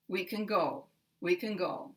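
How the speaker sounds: can very weak, go strongest; stress on GO. Shown as a demonstration. Teacher demonstrating the sentence pattern, stress on go. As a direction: glide over a reduced can and land on go. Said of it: "go has the strongest stress".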